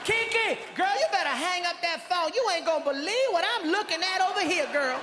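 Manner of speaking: high, fast, calling out to her friend; high sassy woman's voice; sassy woman on phone. Retold or imitated. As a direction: play the sassy lady telling her girlfriend.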